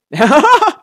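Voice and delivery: high, loud burst of laughter. Real, not acted. Gamer reacting to the screen, loud high cackle. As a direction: burst out laughing at full volume.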